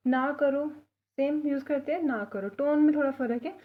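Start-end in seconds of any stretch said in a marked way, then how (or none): none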